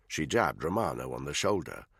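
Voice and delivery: plain reading voice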